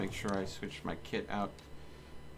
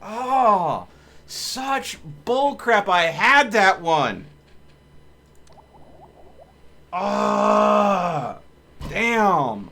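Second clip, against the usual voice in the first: loud, high, drawn-out groaning shout; real furious yell at losing; 9 semitones higher, 14 dB louder, much slower than usual. Real, not acted. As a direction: let the anger burst out slowly. Groaning over the lost fight.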